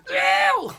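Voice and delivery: [name] scream. Retold or imitated. Testing his limit against the singer, screamed very high. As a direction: scream at top of range. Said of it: "That's as high as I can go".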